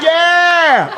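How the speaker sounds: high, loud mock whoop; imitated cheer. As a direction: mimic an audience member's overeager cheer. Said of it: "cheer with food in your mouth".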